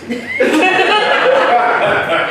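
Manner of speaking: real laughter; high laugh. Real, not acted. Laughs at the crying story.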